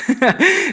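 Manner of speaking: a quick, high amused laugh; high burst of laughter. Real, not acted. Pastor laughing to church about broken bathroom, high laugh. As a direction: laugh out, caught by the joke.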